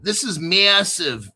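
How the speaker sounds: slow, drawn-out New Jersey "massive"; New Jersey accent. Pretend. Hyping the article to viewers, playful New Jersey accent. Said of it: "is that my New Jersey accent".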